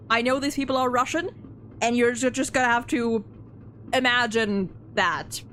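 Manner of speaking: ordinary delivery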